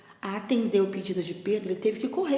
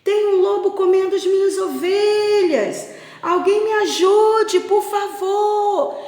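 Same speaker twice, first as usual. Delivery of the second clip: high, loud, drawn-out calls for help; desperate cry for help; 13 semitones higher, 8 dB louder, slower than usual. Acted. Shepherd boy calling villagers to save his sheep from a wolf, desperate shout. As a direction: shout far across the fields, stretching the vowels. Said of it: "Ele gritava tão desesperadamente".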